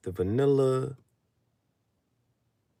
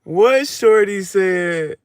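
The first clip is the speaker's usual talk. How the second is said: much higher and louder, slow; excited shout; 9 semitones higher, 8 dB louder, much slower than usual. Real, not acted. To viewers about who said it first, excited. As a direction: squeal out the question.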